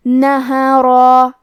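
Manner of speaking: نہرا chanted slowly, with a heavy ra and a long alif; heavy ra demo. Shown as a demonstration. Teacher models the heavy ra for students, slow chant. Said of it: "بھاری آواز میں پڑھنا ہے".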